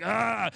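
a high mock cry of frustration, "Ah!"; frustrated cry. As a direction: let out a short exasperated "Ah!". Frustrated spouse venting at night.

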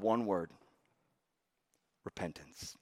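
low, very soft on one word; hushed emphasis. Real, not acted. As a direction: drop to a hush for the key word. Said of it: "preacher drops for emphasis".

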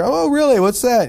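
high-pitched, interested 'oh really'; mock-curious follow-up question; acted polite interest. Retold chat with a seatmate.

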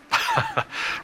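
brief, light chuckle; a quick laugh between sentences; short laugh. Real, not acted. Preacher to congregation on those wanting harm, short laugh.

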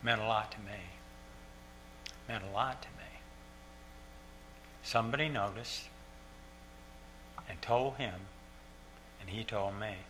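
soft, halting recollection with long pauses; real, subdued emotion; quietly moved. Real, not acted. Moved, recalling late father's praise.

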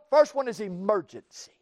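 usual speaking voice

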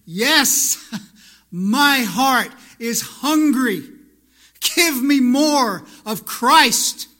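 eager plea. Retold or imitated. Preacher voicing an eager churchgoer, loud and slow. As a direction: cry out like a starving soul. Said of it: "do we come here every week saying".

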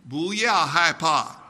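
"Bu yao hai pa" repeated in Mandarin; Chinese phrase. Shown as a demonstration. Preacher leading the congregation in Mandarin, call and repeat. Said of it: "you're gonna say that with me".

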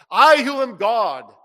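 slow loud declaration; emphatic Jesus. Acted. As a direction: proclaim it slowly as God.

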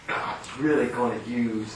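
very soft, low mock preacher; hushed feel-good sermon parody; Joel Osteen. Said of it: "A man that talks like that".

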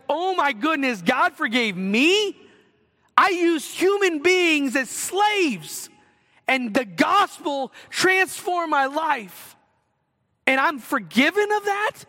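slow, stunned wonder at being forgiven; awestruck disbelief. Retold or imitated. Preacher voicing Newton amazed he is forgiven, stunned wonder. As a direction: marvel at it, voice rising in disbelief.

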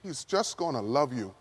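low, hushed and sincere; tender reassurance. Real, not acted. Preacher comforts students who feel unworthy, tender reassurance. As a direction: drop down soft to comfort them.

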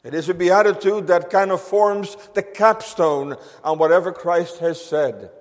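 usual speaking voice

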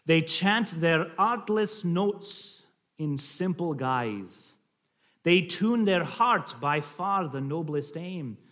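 slow, deliberate Scottish recitation; slight Scottish accent. Shown as a demonstration. Reading of artless family singing, slight Scottish accent. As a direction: stretch the verse out in light Scots. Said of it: "a slight Scottish accent".

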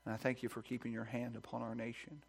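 usual speaking voice

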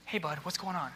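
put-on soft, easy greeting to a kid; open, friendly. Shown as a demonstration. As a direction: invite them to talk, gently. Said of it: "Be open".

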